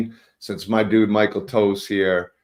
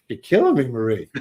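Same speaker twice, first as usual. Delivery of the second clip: exasperated dad; 3 semitones higher, 4 dB louder than usual. Retold or imitated. Father's exasperated quote.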